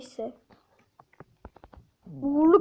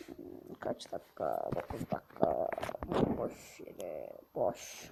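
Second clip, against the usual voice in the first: much lower, softer put-on growl; deep gruff grumble; 11 dB quieter, slower than usual. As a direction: growl the question like a grumpy giant.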